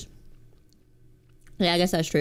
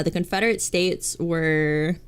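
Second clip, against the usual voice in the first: usual speaking voice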